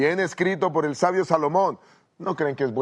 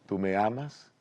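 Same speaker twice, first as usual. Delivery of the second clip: much softer, tender question in her ear; tender whisper-soft; 5 semitones lower, 7 dB quieter than usual. Acted. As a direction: murmur it lovingly into her ear. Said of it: "le dice al oído con ternura". (muy suave y tierno, al oído)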